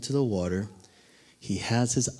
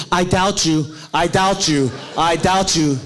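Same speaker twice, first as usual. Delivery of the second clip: loud, high mock whine through laughter; laughing mock Peter; 7 semitones higher, 9 dB louder than usual. Peter yelling his doubt at Jesus, loud and laughing.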